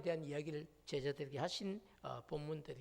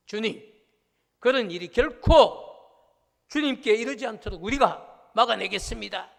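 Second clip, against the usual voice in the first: much louder, higher, insistent protest; forceful protest; 4 semitones higher, 15 dB louder than usual. Retold or imitated. Preacher voicing Peter's protest to Jesus, loud vehement shout. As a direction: Peter swearing to stop it, shouting. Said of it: "지금 이런 얘기를 하고 있는 거예요".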